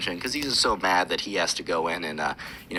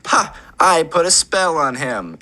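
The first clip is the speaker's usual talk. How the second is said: slow, higher, louder gloating boast; smug wizard kid; 7 semitones higher, 5 dB louder, slower than usual. Retold or imitated. Wizard kid brags to the principal, slow smug gloat. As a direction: gloat like you just won.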